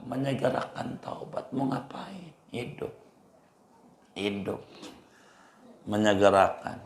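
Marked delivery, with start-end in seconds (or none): 0.1-4.6 much softer, almost under the breath; hushed. Real, not acted. Preacher murmurs to the congregation about repentance, hushed. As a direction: let the words sink to a hush.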